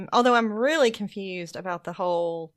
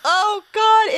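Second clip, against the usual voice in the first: a sudden higher, louder burst of laughter; loud laughing exclamation; 11 semitones higher, 7 dB louder than usual. Real, not acted. Delighted viewer recalling a funny scene, loud laughing cry.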